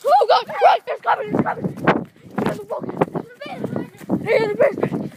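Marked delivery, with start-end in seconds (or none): none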